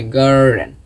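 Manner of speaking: American গার্ডেন with a clear R, gar-den; American pronunciation. Shown as a demonstration. Teacher showing the American গার্ডেন he is learning, R sounded. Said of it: "আর American".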